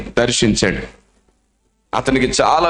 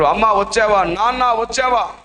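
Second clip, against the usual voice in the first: higher, calling out for mother and father; 7 semitones higher than usual. Acted. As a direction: call out hopefully into the dark. Boy calls out for parents.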